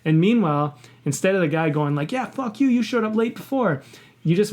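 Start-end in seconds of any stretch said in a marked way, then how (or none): 2.1-3.8 high-pitched, tit-for-tat retort; mock angry comeback. Friend throws lateness back at his accuser, mock anger. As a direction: imitate someone firing blame back, not shouted. Acted comeback in a retold quarrel.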